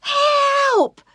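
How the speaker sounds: very high, loud scream for help. Acted. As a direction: scream it into the darkness. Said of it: "they screamed".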